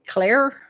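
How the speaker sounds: "Claire" with the final R sounded, rising; trying another pronunciation. Shown as a demonstration. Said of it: "is that how you would say it?".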